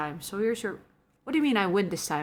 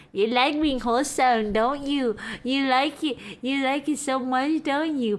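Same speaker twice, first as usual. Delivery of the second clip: higher, put-on teasing voice; playful teasing; 4 semitones higher than usual. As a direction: tease them in mock accusation. Playfully teasing a viewer as wholesome.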